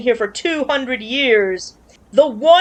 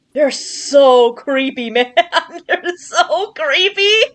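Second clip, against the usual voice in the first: laughing; 6 semitones higher, much slower than usual. Real, not acted. Laughing at creepy creatures.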